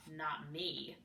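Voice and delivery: low, soft sulky grumble; grumbling. A narrator's reading. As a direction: grumble it under your breath. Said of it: "Sarah grumbled".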